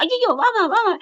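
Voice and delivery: high, loud eager "come, come"; eager calling back. Retold or imitated. Husband pulling wife back for a hug, high eager call. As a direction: call her back in a rush of delight.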